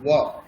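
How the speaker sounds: touch teeth to lip for ওয়া. Shown as a demonstration. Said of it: "নিজেরা অভ্যাস করুন".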